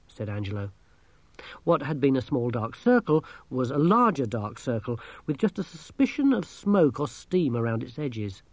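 plain reading voice

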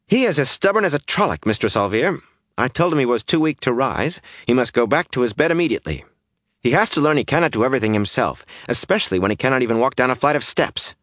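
fast, high-pitched indignant complaint; exasperated. A narrator's reading. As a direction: rattle off the grievance in exasperation. Exasperated complaint about stubborn Perrin.

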